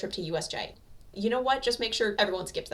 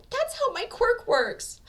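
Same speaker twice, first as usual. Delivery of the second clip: high, slow, tearful but stern; stern through tears; 4 dB louder, much slower than usual. A narrator's reading. Hanako scolding Bakugo through tears, high and slow. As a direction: hold firm while crying, pitched high. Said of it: "says sternly through her tears".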